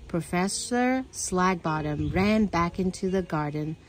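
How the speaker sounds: ordinary delivery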